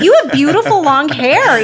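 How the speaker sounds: high, laughing gush of admiration; gushing compliment. Retold or imitated. Woman complimenting trainer's long hair, gushing. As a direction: reenact being smitten, voice swooping up.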